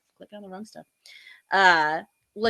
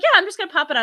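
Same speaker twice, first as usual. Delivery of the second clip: higher casual voice; husband voice; 9 semitones higher, 6 dB louder, much faster than usual. Retold or imitated. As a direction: lightly voice the husband.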